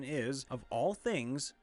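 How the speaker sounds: ordinary delivery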